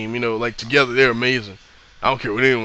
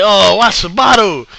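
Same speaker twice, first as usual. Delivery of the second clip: higher, louder, slow mock outrage; exasperated fan protest; 6 semitones higher, 6 dB louder than usual. Fan complains that Sabato is referee, slow loud exasperation. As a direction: drag out the complaint like a fed-up fan.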